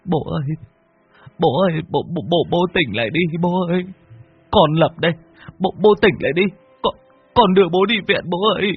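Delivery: high tearful stammering pleas; blubbering. A narrator's reading. Son begging father to wake up, blubbering. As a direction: plead through tears, tripping over words. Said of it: "mếu máo mà khóc".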